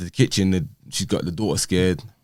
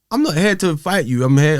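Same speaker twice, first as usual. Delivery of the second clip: higher and louder, pleading frustration; retelling his plea in a raised voice; exasperated pleading; 9 semitones higher, 5 dB louder than usual. Retold or imitated. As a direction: insist you're not here to fight. Retold argument with ex.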